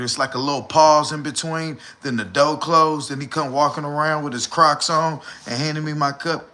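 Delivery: usual speaking voice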